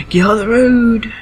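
loud, high, slowed shout. Real, not acted. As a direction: yell it at the driver. Yelling at driver in game.

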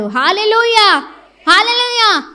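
a preacher's rousing loud cry of praise; loud hallelujah. Real, not acted. Preacher rousing the congregation: loud high hallelujah.

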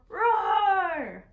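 put-on big loud, high animal roar for the kids; playful roar. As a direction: roar like a polar bear at full volume. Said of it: "give a roar".

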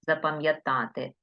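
ordinary talk

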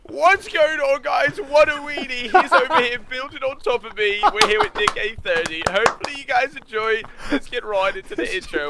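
high-pitched, hyped YouTube intro; Ali A impression. Streamer greeting viewers in an Ali A impression. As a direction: go high and eager like a video opener. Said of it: "I'll try Ali A".

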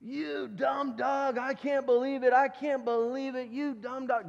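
high-pitched, drawn-out mock tirade; exasperated owner, hammed up; acted angry scolding. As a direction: rip into the dog in a put-on voice. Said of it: "rips into this intelligent animal".

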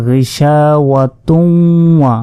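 গিশাওয়াতুন held on a nasal hum into ওয়া; joined without pause. Shown as a demonstration. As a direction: slowly link গিশাওয়াতুন to ওয়া, sounding the tun. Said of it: "এখানে যদি আমরা ওয়াকফ না করি".

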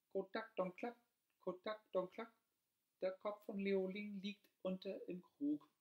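high clucking hen voice. A narrator's reading. The hen clucking out the new hiding place, high-pitched. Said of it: "die Henne war wieder zu hören".

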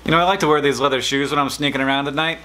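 ordinary talk, nothing marked

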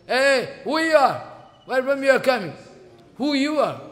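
loud, high demanding challenge; challenging shout. Retold or imitated. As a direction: bark the question at the stranger. God confronts wind god.

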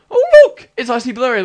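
thrilled to spot a friend; excited discovery. Real, not acted.

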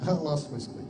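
normal talking voice

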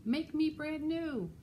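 high, drawn-out melted snowman voice; high, slow character call; voice from the hot tub. A narrator's reading. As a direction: stretch out a high little plea. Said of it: "A voice from the puddle said".

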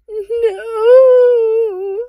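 very high, loud crying shriek. Acted. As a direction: scream in terror. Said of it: "Umbridge's voice rose in a shriek".

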